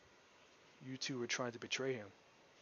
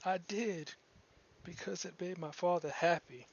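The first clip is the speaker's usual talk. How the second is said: put-on young girl; 6 semitones higher, 4 dB louder than usual. NPC girl answers party.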